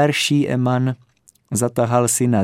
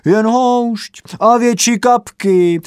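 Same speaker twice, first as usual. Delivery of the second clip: high, loud egging-on cry; encouraging cheer; 13 semitones higher, 5 dB louder than usual. A narrator's reading. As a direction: cheer them to pile on more goals. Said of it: "zvolal povzbudivě".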